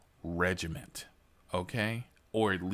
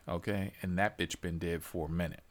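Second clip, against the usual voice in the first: ordinary talk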